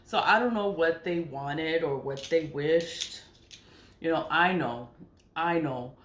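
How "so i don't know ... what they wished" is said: ordinary talk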